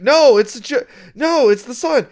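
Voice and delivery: mock alarm. Vampire realising sun is burning him.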